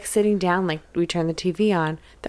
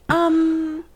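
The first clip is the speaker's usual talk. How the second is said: evasive parent; 8 semitones higher than usual. Retold or imitated. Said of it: "And then I was like".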